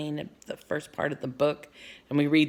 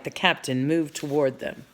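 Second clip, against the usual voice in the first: usual speaking voice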